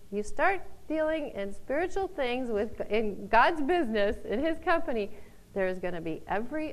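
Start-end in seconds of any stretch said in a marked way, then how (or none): none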